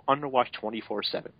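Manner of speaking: ordinary talk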